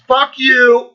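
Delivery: hammed-up angry outburst; mock furious curse. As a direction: spit it out like a sulky tantrum. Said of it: "ran the fucking gamut of fucking emotions".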